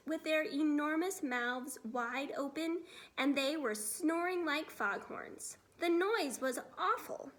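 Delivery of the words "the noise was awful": ordinary delivery, nothing marked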